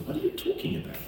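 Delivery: retold, high-pitched disbelief; incredulous protest. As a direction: question it in astonished high pitch. Stunned reply to a neighbor's snub.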